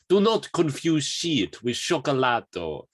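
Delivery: slow, high, hammed-up Italian accent; Italian accent impression. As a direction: relish it in a big theatrical Italian voice. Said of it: "I thought that was a really good impression".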